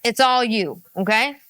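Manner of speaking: ordinary talk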